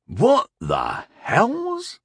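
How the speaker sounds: high, very drawn-out disbelief; slow, stunned question; incredulous. A narrator's reading. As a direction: stretch each word, rising in bafflement. Captain baffled by boy's challenge.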